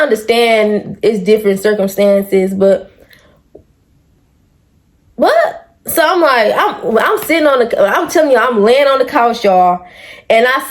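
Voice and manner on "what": sudden high-pitched what?; shocked what. Real, not acted. She reads the comment and shrieks in shock. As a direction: jolt up in disbelief.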